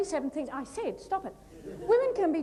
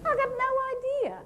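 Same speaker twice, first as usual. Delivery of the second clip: much higher, mock-baffled squeak of confusion; 11 semitones higher than usual. Telling the agent about northeast, a much higher baffled cry. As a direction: toss it up high, utterly lost. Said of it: "and I'm going".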